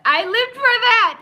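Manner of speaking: high, loud, delighted cry; gleeful shout. Real, not acted. Host relishing the show's fight scene, gleeful shout. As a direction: belt it out with pure glee. Repeats line, second much louder.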